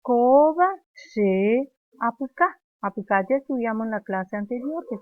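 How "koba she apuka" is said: slow, loud, Koba held high; slow loud drill. Shown as a demonstration. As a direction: drill the line slowly out loud. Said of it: "el nene".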